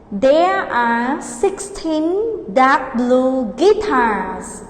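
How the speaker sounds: slow, clear model sentence; slow model answer. Shown as a demonstration. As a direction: say it slowly for the class to repeat. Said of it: "teacher models the answer slowly".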